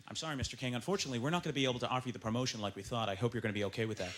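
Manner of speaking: low, soft, composed boss voice; gentle bad news. Boss telling employee about lost promotion, gentle bad news. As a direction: let them down softly and formally.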